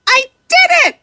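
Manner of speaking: high triumphant little voice; happy shout. A narrator's reading. Little fish after blocking the filter, happy shout. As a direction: burst out proud of yourself. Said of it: "he shouts happily".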